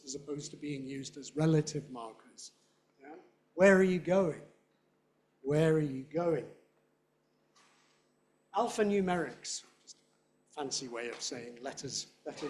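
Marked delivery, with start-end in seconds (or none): 3.5-6.5 loud "Where", weaker "are you"; stressed question word. Shown as a demonstration. Teacher modelling interrogatives, stress on where. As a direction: punch "Where", then fall away through "going". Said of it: "Interrogative words when they're actually in questions".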